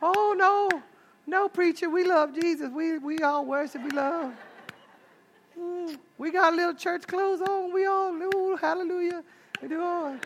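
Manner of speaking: high, soft, put-on holy voice; hammed-up piety, high-pitched and airy; mock-pious churchgoers. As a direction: gush sweetly like an oh-so-spiritual church lady. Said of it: "y'all act all spiritual and like".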